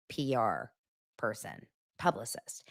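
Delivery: quick whispered correction; whispered aside. Real, not acted.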